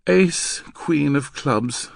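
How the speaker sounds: high, slow, tired recital; weary. Acted. Girl answering the code drill, weary. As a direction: list the cards wearily. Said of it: "the girl replied wearily".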